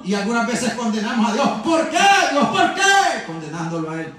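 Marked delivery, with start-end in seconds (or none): 1.6-3.2 high, loud cry of complaint; reproachful outcry. Acted. Preacher acting out believers blaming God, reproachful outcry. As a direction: cry out as if accusing heaven.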